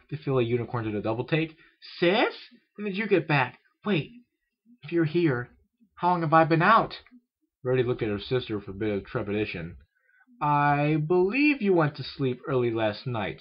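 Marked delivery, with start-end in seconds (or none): 2.0-7.0 put-on high, loud, questioning young voice; young filly, bewildered. A narrator's reading. Filly surprised to find her sister home, high bewildered voice. 10.4-13.4 high, slow, deliberate voice; older sister character. A narrator's reading. Older sister answering the confused filly, slow high voice.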